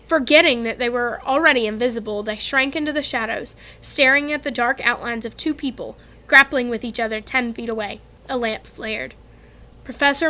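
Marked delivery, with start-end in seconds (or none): none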